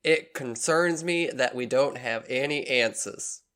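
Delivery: slow southern drawl; southern accent. Acted. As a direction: read it as a southern police lieutenant. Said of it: "how to do a southern voice".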